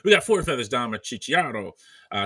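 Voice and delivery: ordinary talk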